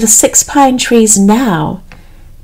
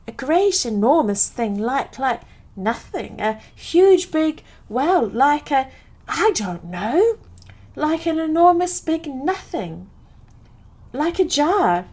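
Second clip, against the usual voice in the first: lifted pitch, halting bursts; flustered fright; 5 semitones higher, 8 dB quieter than usual. A narrator's reading. Piglet struggling to describe the enormous thing, flustered. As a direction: search for words as a rattled Piglet.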